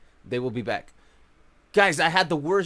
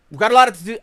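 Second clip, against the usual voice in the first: louder, punchy we got a lot to do; emphatic; 2 semitones higher, 10 dB louder, much faster than usual. Real, not acted. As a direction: hype it up loud. Louder first take.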